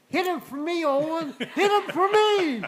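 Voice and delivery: loud, high yell with a laugh in it; hollering. Retold or imitated. Ray Fox Junior hollering at Owen from under the truck, loud and high, laughing. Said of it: "he's hollering at me".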